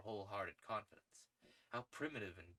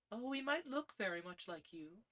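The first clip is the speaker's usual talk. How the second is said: put-on higher young woman's voice, light; Gladys teasing; 12 semitones higher than usual. A narrator's reading. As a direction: tease him lightly.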